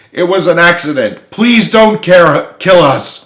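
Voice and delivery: high, loud, slow begging; pleading cry. A narrator's reading. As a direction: beg for mercy on his knees. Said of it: "cried Eddie".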